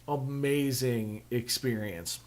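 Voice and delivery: ordinary talk, nothing marked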